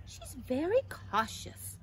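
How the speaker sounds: lightly voiced gossiping caterpillar; another caterpillar's remark. A narrator's reading. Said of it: "said another".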